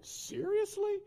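very high-pitched mock disbelief; incredulous eye-roll. Wife woken by the boss's call, exasperated disbelief. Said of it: "rolling her eyes like".

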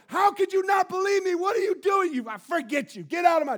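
high, loud furious shout; angry God. Retold or imitated. The God we expect, shouting at us furiously. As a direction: blast them like an enraged God. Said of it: "Get angry at us".

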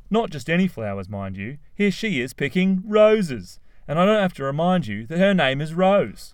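high, loud, gushing; eager Cliff. A narrator's reading. Cliff gushing to his parents about meeting Rose, eager. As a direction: tell it like a wonder.

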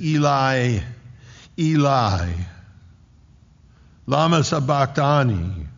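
a controlled imitation of an anguished scream; retold cry out. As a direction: call it out loudly, as if screaming. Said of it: "He screams out, and as he screams out".

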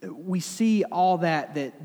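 ordinary talk, nothing marked

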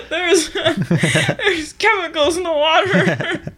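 slow, laughing conspiracy rant; Alex Jones style, laughing. Acted. Riffing after co-host's Alex Jones remark, laughing. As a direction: laugh through a mock conspiracy line. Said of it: "Sounds like Alex Jones almost".

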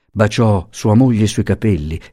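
ordinary delivery

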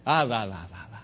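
put-on babbled again in a changed, baby-like voice; lightly imitated baby sounds; baby babble. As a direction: repeat it like a baby learning to talk. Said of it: "That's the idea".

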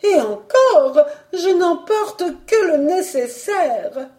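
high, slow, emphatic protest; self-justifying baroness, loud start; agitated baroness. Acted. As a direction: draw it out in a high indignant voice. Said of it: "elle ajouta".

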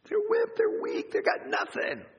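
high, breathy scornful sneer; mock sneer at the weak builders; sneering taunt. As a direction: spit it out dismissively. Voicing the mockers' sneers.

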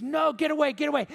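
half-voiced scream, backing away in fear; mock scream. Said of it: "the boy starts backing up screaming".

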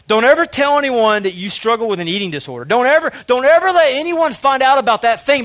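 Satan's whisper. Retold or imitated. Satan warning the listener to keep the past hidden, building to loud pressure. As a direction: voice Satan as an accuser that swells and grows urgent. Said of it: "we let Satan go".

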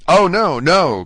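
loud, high cry of protest. A narrator's reading. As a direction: cry out in urgent denial. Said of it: "cried Dante".

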